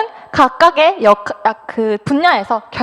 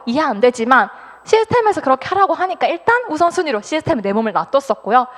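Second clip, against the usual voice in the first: normal talking voice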